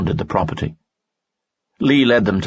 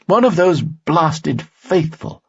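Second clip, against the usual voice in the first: higher, courtly nobleman voice; a charming noble, pitched up; Lanson's voice; 11 semitones higher, 3 dB louder than usual. Acted. Lanson speaks in story.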